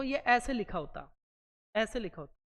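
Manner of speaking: normal talking voice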